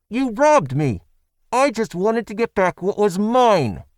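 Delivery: high, loud, slow woman's complaint; aggrieved accusation. A narrator's reading. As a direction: accuse them, wounded and indignant. Mouse accusing her captors.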